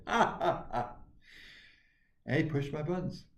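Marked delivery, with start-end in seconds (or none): 0.1-1.0 high, chopped mocking laugh; bully's laugh. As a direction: cap the taunt with a fake laugh.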